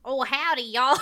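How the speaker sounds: hammed-up Southern Giant accent; fake Southern accent. Player answering the giant in Giant, fake Southern accent. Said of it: "the opposite of fake Russian is fake Southern".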